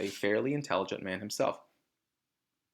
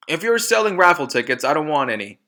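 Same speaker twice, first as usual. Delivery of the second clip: higher and much louder, rude and dismissive; rude loud father; 5 semitones higher, 9 dB louder than usual. Acted. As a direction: shoo the visitor away loudly.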